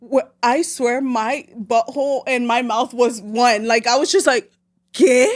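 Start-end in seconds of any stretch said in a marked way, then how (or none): 4.9-5.3 louder, a stunned "¿Qué?" then a disbelieving question; shocked disbelief. Retold or imitated. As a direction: react like you just saw the positive test. Reaction to positive test.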